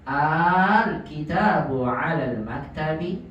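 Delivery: "Al" drawn out long at the start; slow question reading, "Al" stretched; lengthened Al. Shown as a demonstration. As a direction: hold the a of "Al" for several beats.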